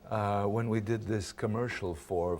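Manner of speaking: ordinary talk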